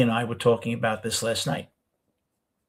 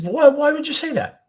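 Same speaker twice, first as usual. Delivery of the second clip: much higher and louder, disbelieving why; astonished question; 6 dB louder than usual. Retold or imitated. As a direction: ask it stunned, voice jumping up. Shocked question to him.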